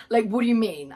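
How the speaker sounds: ordinary talk, nothing marked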